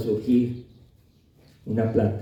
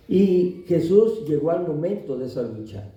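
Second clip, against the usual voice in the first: normal talking voice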